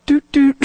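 high, loud double toot; imitated horn honk. Driver honking in a traffic jam, imitated horn. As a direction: honk like a car horn with your voice.

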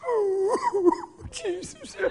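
high, giddy ooh with laugh; delighted ooh. Retold or imitated. Believer reading a mirror note, high and giddy. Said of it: "And you'll read it and go".

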